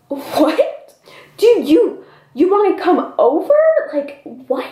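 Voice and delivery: high-pitched, halting disbelief; incredulous reaction. Acted. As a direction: act out baffled, squeaky outrage. Acted disbelief at asking to come over.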